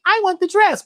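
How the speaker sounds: put-on high, whiny little girl demand; insistent daughter. Imagined daughter insisting on the dress, high and whiny. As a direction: insist like a child.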